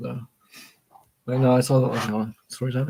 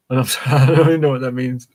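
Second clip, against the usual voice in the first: loud, giggly confession; laughing apology; 11 dB louder than usual. Real, not acted. Reader apologises for nonsense remark, loud and laughing. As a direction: burst out apologising through laughter.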